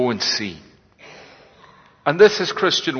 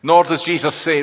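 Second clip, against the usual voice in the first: usual speaking voice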